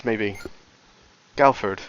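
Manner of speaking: normal talking voice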